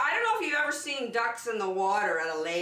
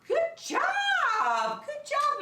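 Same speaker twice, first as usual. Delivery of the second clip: high, delighted good job; big proud teacher praise; excited praise; 3 dB louder, much slower than usual. Real, not acted. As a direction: cheer the little ones on. Praising kids after the song.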